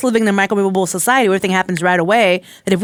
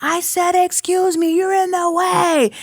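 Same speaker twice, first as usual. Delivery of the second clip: high-pitched mock yell without real volume; strained high, drawn-out mock holler; retold yell; 10 semitones higher, slower than usual. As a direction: imitate an exasperated shopper yelling, held back. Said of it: "the guy yells for the third time".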